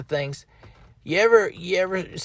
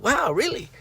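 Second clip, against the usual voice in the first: mock amazement; 7 semitones higher than usual. Retold amazement at doctor.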